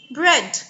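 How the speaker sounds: Bread said slowly and clearly; Bread, demo pronunciation; model word. Shown as a demonstration. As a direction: say Bread with a crisp short e. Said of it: "So you can see the difference in the sound".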